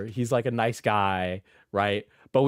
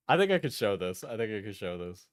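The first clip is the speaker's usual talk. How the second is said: ordinary talk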